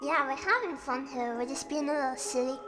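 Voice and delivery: usual speaking voice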